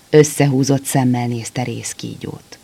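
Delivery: ordinary delivery, nothing marked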